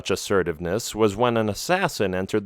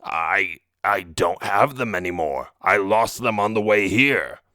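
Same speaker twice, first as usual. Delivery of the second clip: troll voice. A narrator's reading. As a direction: voice a cornered troll making excuses. Troll confronted about stolen diamonds.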